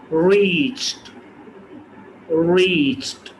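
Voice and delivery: रीच्ड ending in t. Shown as a demonstration. Teacher models रीच्ड with final t. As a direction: say रीच्ड as richt. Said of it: "रीच्ड है".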